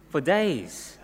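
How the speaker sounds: ordinary delivery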